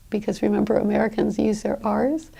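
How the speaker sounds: usual speaking voice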